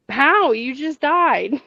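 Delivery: loud, high how, then a slow you just died; stunned disbelief, drawn out; disbelieving. Retold or imitated. Woman telling her dead friend he just died, stunned.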